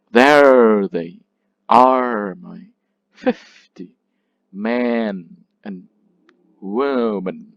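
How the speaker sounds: very slow beats, THERE and ARE long and loud, "they" and "my" weak; trochaic scansion. Shown as a demonstration. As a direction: hit "There", "are", "fif", "men", "wo", dropping between. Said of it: "a stressed syllable followed".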